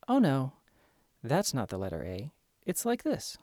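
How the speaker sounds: put-on soft, gentle correcting of a child; quietly steer a five-year-old right; calm kindergarten teacher. Shown as a demonstration. Teacher gently correcting a five-year-old's letter A, soft and calm. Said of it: "a kindergarten teacher, just calmly, quietly".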